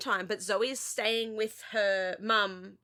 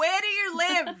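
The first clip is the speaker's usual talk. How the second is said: exasperated; 7 semitones higher, 3 dB louder than usual. Retold or imitated. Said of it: "I'm like".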